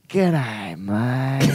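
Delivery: slow, drawn-out put-on Australian; attempted Australian voice, each word stretched; Australian accent. Shown as a demonstration. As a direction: do a hammed-up Aussie greeting. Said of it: "Can you do your Australian voice".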